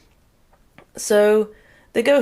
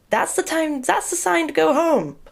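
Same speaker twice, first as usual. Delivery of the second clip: much higher, exasperated sing-song; exasperated sarcasm; 10 semitones higher than usual. Retold or imitated. Telling the cartoon kids to go home, in exasperated high sarcasm. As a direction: spell out the obvious in disbelief.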